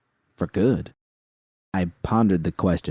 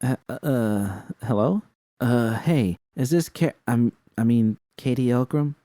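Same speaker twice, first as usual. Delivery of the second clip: confused; 5 semitones higher than usual. Acted. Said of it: "thoroughly confused".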